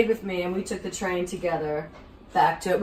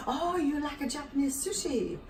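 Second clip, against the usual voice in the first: higher, put-on Japanese accent; imitating the owner's Japanese lilt; mock Japanese accent; 7 semitones higher, 6 dB quieter than usual. Japanese owner asking about sushi, mock Japanese accent.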